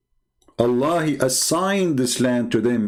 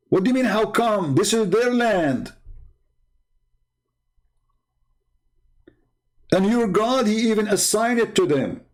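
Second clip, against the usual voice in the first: heated rant; 8 semitones higher than usual. Real, not acted.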